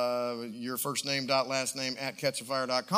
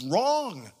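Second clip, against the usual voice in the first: normal talking voice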